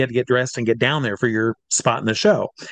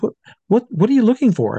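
much higher, insistent alarm; alarmed question; 10 semitones higher, 3 dB louder, faster than usual. Retold or imitated. Showgirl asking why he checks her feet, alarmed. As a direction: fire the question in a panic.

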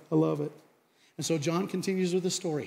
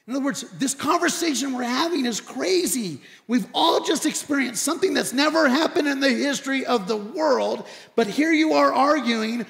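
high, loud, building to a shout; heated argument; 6 semitones higher, 6 dB louder than usual. Retold or imitated. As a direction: plead incredulously, exploding on the last words. Man's speech acted as heated argument.

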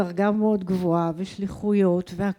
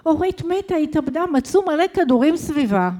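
urgent retold news; 6 semitones higher, 4 dB louder than usual. Husband phones with shocking news.

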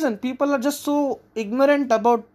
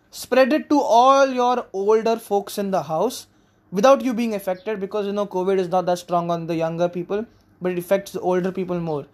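ordinary talk

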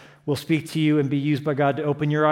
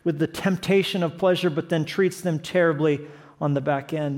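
ordinary talk, nothing marked

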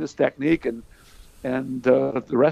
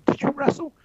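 cocky challenge. Retold or imitated. Cocky teen challenging coach.

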